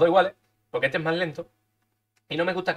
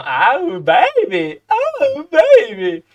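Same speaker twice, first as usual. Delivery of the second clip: playful voice; 9 semitones higher, 9 dB louder, much slower than usual. Pretend. Celebrating a good roll.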